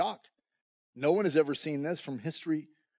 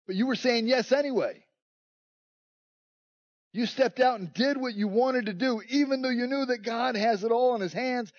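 higher, building sermon delivery; rising preacher's fervour; preaching; 5 semitones higher than usual. Acted. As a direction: press the point to the congregation.

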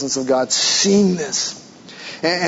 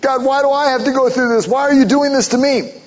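higher, pleading questions to God; pleading cry; 8 semitones higher than usual. Retold or imitated. Believer crying out to God in trials, pleading higher. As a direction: cry out why like a hurting believer. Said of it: "We cry out to God".

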